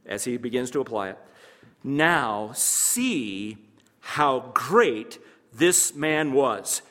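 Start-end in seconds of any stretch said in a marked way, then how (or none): 1.8-6.8 drawn-out slow proclamation; exclamation read. Shown as a demonstration. Preacher reading verse to congregation, slow exclaiming. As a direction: stretch "great" as a marvel. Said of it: "Exclamation point".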